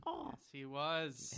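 slow, drawn-out dragon voice; half dragon voice. Acted. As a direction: stretch it out like a lazy dragon. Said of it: "that was his dragon voice".

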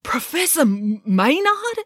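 high, startled cry of the name; stumble, then blurt the name; a shocked boy's exclamation, pitched high; exclaiming. A narrator's reading. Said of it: "exclaimed Joe".

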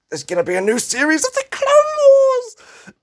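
very high, loud squeal of joy; excited scream. Retold or imitated. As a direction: blurt it out like a thrilled fan. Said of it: "I screamed, a scream of excitement".

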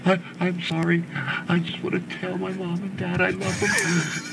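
high-pitched desperate apology; Blair Witch confession. Acted. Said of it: "It's so good. That was literally perfect to a T".

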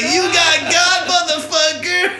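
high, slow laughing yell; mock shout. Friend howling at the teen who ate dog pills. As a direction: stretch it out high through laughter. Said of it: "he was like".

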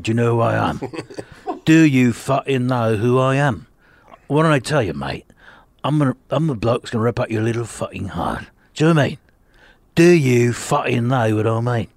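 gruff London threat, Cockney menace; Jason Statham impression. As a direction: growl it like a London hard man. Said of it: "teach myself how to do Jason Statham".

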